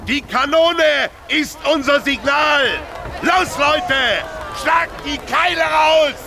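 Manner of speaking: high, slow, loud shout. Acted. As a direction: bellow the order to the crowd. Foreman shouting signal to workers.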